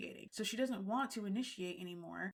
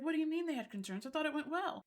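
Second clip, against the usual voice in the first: high, quick baffled protest; mock bewilderment; 7 semitones higher than usual. Imitating Adam hearing friends had concerns, taken aback. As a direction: imitate someone caught off guard.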